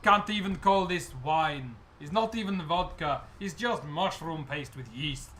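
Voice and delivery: put-on slow lament in a Russian accent; Russian-accented goblin. Goblin admitting the wine is fake, slow Russian-accented lament. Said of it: "doing a Russian accent for some reason".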